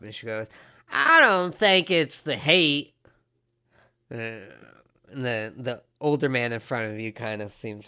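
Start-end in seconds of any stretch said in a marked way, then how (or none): 0.9-2.9 loud, high, drawn-out interjection; woman speaking up. Acted. Woman behind the counter butts in, speaking up loudly. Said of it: "you hear the woman behind the, the counter speak up".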